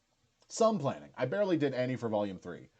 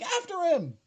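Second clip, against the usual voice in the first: high mock rallying call; imitating a chase cry; 5 dB louder than usual. Retelling the security guards chasing him, high mock call. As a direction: call them to the chase, far higher. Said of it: "they're just like".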